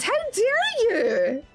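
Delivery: ordinary delivery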